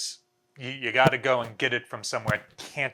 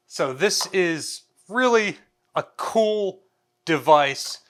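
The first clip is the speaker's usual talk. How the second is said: excited; 5 semitones higher, 5 dB louder, slower than usual. Real, not acted. Enthusing over the radio.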